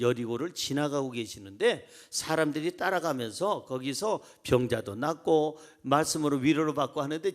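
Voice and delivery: ordinary talk, nothing marked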